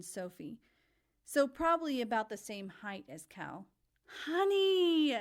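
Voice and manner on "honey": high, loud joyful cry; exclaiming. A narrator's reading. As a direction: call it out with delight. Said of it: "he exclaimed".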